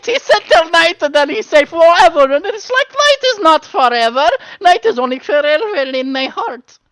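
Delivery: very high, loud wailing rant; hammed-up goblin tantrum; aggrieved goblin. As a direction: plead the case in a tearful screech.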